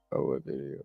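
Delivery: whispered aside to herself. Retold or imitated. Realising which video a viewer meant, whispered. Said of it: "I just was like".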